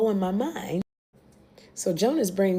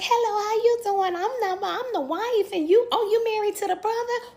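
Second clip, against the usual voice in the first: much higher fake-polite greeting; sugary as a trap; first wife faking niceness, pitched high; mock sweet Joyce; 12 semitones higher than usual. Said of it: "and Joyce is like".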